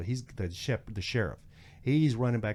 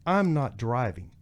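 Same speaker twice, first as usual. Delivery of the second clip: emphatic, higher-pitched argument; retold indignant protest; 5 semitones higher, 3 dB louder than usual. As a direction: re-enact standing up to the officer. Arguing back at deputy, retold.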